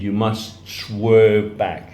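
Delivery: slow, weighty emphasis; slow and drawn out. Shown as a demonstration. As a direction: stretch out swerve. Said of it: "like a young tree in the wind".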